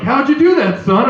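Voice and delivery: loud, high astonished question; mock amazement, pitch jumping up; amazed dad. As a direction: blurt it out, impressed. Impressed dad voiced in a bit.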